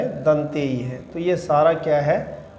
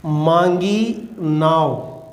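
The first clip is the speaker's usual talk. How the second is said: "मांगी नाव" drawn out word by word; slowly quoted verse; much slower than usual. Shown as a demonstration. Teacher reads "मांगी नाव" slowly to students. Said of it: "कैसे?".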